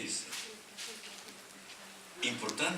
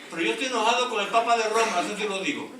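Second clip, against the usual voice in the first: raised, louder preaching voice, higher; loud declaration; 8 semitones higher, 10 dB louder than usual. Real, not acted. Preacher tells the congregation he is angry, loud and high. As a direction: proclaim it boldly to the whole church.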